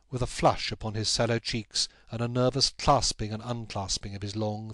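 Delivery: ordinary delivery, nothing marked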